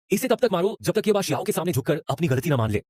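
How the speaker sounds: usual speaking voice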